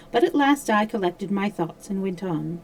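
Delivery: usual speaking voice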